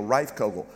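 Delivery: "Reifkogel" said as RIFE-koh-gul, stress on the first syllable; name pronounced carefully. Shown as a demonstration. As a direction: spell out "Reifkogel" as rife-ko-gel. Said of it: "my last name is pronounced Reifkogel".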